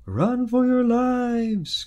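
high, loud, drawn-out cry of panic; slow, high panicked yell. Acted. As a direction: townsfolk fleeing in terror. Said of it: "cried the people of Pee-wee".

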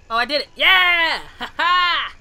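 loud, high whoop with a laugh; triumphant cheer. Real, not acted. Gamer celebrating after doing it, triumphant. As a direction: cheer your win at full volume.

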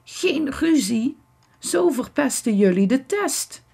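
hoog klagend kreunen; kreunend. A narrator's reading. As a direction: smeek ze kreunend te stoppen. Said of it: "kreunt Victor". (klagend kreunen)

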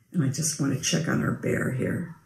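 hushed whisper, so the bear keeps sleeping. Pretend. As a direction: lean in and whisper softly.

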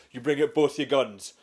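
ordinary delivery

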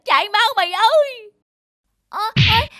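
high, loud panicked yelp; panicked cry. Acted. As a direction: scream in fear while running. Villains flee in panic.

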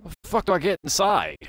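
high, loud exasperated yell at the game; real gamer rage, quick and high; frustrated outburst. Real, not acted. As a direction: blurt it out, fed up and stuck. Stuck outside, frustrated at the game.

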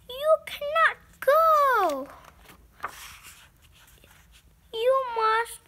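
loud, high, desperate begging; pleading shout. Acted. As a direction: cry out for a friend not to leave. Gerald begs Piggie not to leave.

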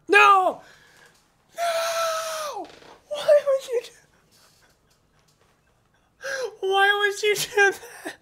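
high anguished yell of no and why; despairing shout. Real, not acted. Streamer screams at the game for deleting items, anguished. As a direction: cry out in real disbelief as your loot vanishes.

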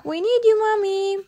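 high loud call. A narrator's reading. Peppa calling Mommy in the wind, high loud call. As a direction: call for help.

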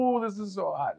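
mock yelp at something too hot; reacting to heat. Acts reaction to hot candy.

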